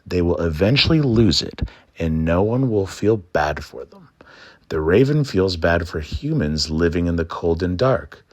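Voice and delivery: ordinary delivery, nothing marked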